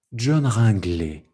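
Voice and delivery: descend through "John Ringley" to the end; progressive fall. Shown as a demonstration. Teacher demonstrating the descent, progressively falling. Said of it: "et je descends".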